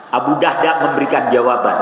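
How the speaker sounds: ordinary talk, nothing marked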